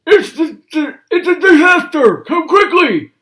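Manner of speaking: high, loud panicked calls; frantic old man yelling from his room; Grandpa still shouting. Acted. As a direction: shout for the family to hurry. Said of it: "He was still shouting".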